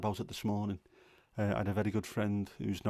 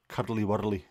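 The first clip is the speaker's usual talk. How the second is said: Curly Wurly in mock Glaswegian, as "Cuddly Wurly"; Glaswegian accent; 4 dB louder than usual. Shown as a demonstration. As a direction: imitate a Glasgow mate saying Curly Wurly. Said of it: "either a Glaswegian or a Dublin accent".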